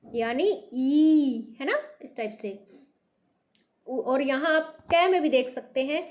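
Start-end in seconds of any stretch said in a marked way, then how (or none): 0.0-1.6 यानी ई with the ई drawn out very slowly; teacher demo stressing ई; stressed long ee. Shown as a demonstration. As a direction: stretch the ई long and press on it. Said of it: "stress देके इसे बोलेंगे".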